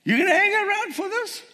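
very high, quick squeaky question; incredulous squeal. Acted. Preacher to congregation about fleeing, high incredulous question. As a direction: shoot right up high in mock disbelief. Joking incredulity at dead boy rising.

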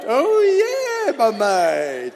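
high ecstatic cry; passionate cry. Real, not acted. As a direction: cry out with delight. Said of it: "he took it passionately".

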